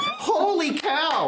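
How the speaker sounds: high, drawn-out amazement; amazed exclamation. Real, not acted. Game master reacts to a lucky roll, amazed. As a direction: stretch it out high, half laughing.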